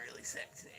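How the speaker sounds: whispered aside. Real, not acted.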